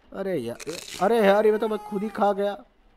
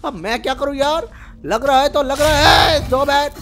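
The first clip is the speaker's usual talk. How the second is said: loud, high-pitched complaint; exasperated protest; 7 semitones higher, 5 dB louder than usual. Real, not acted. Player arguing back about the snowman, exasperated shouting. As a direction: protest at full voice, building louder.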